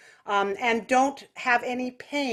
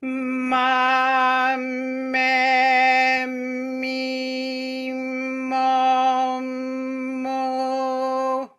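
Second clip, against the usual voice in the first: nasal quacky; much slower than usual. Shown as a demonstration. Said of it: "so nasal quacky".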